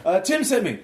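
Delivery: high, insistent woman's voice. Acted. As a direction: keep it high and pushy.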